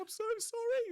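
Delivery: very high, loud mortified apology; squealed sorry; frantic apology. Retold or imitated. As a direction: grovel in panic. Apologising to blouse owner.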